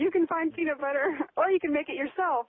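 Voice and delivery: tossing the line back with a laugh; amused echo. Real, not acted.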